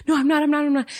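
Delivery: high, rapid pleading denial; retold panicky babble; panicked denial. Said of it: "I start panicking".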